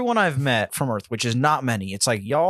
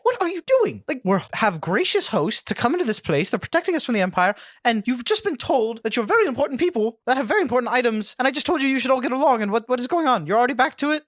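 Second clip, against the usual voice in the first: high, fast scolding voice; a flustered official berating the party; scolding NPC; 7 semitones higher, faster than usual. Acted. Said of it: "she's, like, holding her hand over the sending stone".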